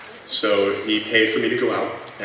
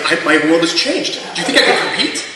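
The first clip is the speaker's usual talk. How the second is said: high, laughing mock wonder; mock awe; 7 semitones higher, 7 dB louder than usual. [name] fake-thanking the instructor, laughing mock awe. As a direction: ham up the amazement while laughing.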